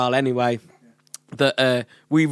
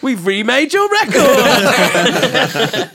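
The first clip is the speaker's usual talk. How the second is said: high, loud, very drawn-out announcement; gleeful reveal; 10 semitones higher, 7 dB louder, much slower than usual. Retold or imitated. Producer revealing the prank to the artist, gleeful. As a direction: sing it out slowly like a big surprise. Reveals the good news, retold.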